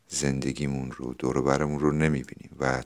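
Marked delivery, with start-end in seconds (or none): none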